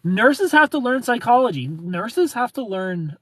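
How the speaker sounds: loud, high-pitched insistence; emphatic rebuttal. Real, not acted. Streamer correcting a claim about nurses, loudly emphatic. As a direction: push the point hard and high.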